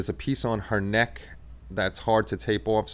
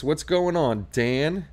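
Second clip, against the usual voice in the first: ordinary talk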